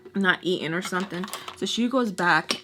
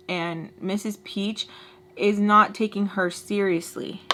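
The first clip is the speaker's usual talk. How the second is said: ordinary talk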